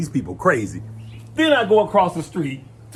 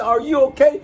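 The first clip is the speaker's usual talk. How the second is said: concerned question; 9 semitones higher, 4 dB louder than usual. Retold or imitated. Lady checks on screaming man.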